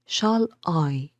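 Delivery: "Shall" light, "I" stressed. Shown as a demonstration. Teacher marking the first iambic foot, stress on "I". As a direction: lean on the second word, "I". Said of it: "the stress is on I".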